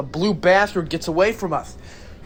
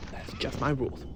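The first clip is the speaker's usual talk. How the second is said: softer, lower muttered repeat; quiet aside; 6 semitones lower, 11 dB quieter than usual. Shown as a demonstration. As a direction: repeat it under your breath. Repeated rule, second take dropped.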